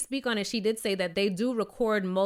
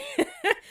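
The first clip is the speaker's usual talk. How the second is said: high laugh. Real, not acted. Laughing after joke.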